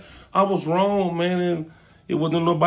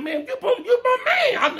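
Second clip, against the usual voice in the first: laughing plea. Retold or imitated. Pleading with friend, laughing.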